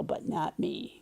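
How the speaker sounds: dropping to a near whisper on "but not me"; nervous whispered plea. Retold or imitated. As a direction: beg quietly, almost under the breath. Moses pleading to be spared.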